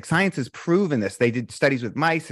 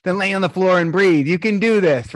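coaching pep talk; 5 semitones higher, 3 dB louder than usual. Retold or imitated. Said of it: "And I'm going".

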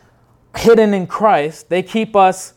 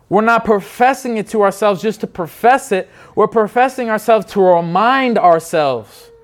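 preaching with force; 3 semitones higher than usual. Real, not acted. Preaching climax builds.

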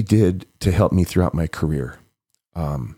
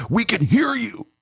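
urgent warning; 14 semitones higher than usual. Retold or imitated. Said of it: "he's like".

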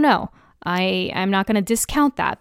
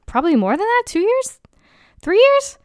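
rising excitement; 12 semitones higher, 3 dB louder than usual. Real, not acted. Guessing how long listening.

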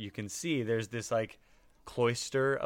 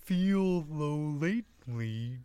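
slow halting comic drawl; Emo Phillips voice; 6 semitones higher, much slower than usual. Acted. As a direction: draw words out with odd pauses. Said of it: "I'm doing Emo Phillips".

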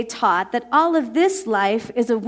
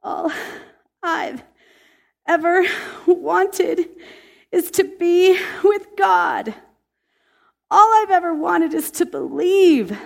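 high, slow, word-by-word pleading; tearful plea; 8 semitones higher, 4 dB louder than usual. Retold or imitated. Young woman confessing her longing for God, high and halting. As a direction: draw it out as if through tears. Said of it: "through sobbing tears".

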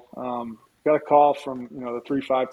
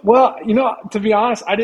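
talking through a real laugh, voice lifted high; giggly, bashful start to a reply; 10 semitones higher, 7 dB louder than usual. Real, not acted. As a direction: laugh it off while starting to answer. Laughing at host's All-American remark.

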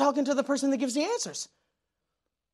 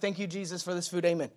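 much softer, rushed throwaway grace; a hint of mocking a shallow prayer; mock quick prayer; 5 semitones lower, 10 dB quieter than usual. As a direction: toss off a lazy mealtime prayer.

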